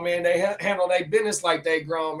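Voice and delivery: usual speaking voice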